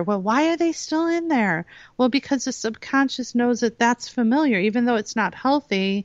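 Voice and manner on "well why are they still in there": higher, puzzled question; wondering onlookers. Acted. People wonder about those in abusive situations, puzzled. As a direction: voice baffled people asking why. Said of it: "people wonder".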